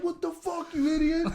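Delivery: high-pitched mock outrage; retold angry biker.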